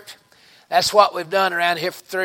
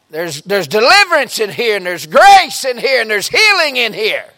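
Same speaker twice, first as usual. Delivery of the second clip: ordinary talk, nothing marked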